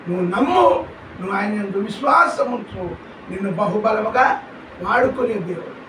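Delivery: usual speaking voice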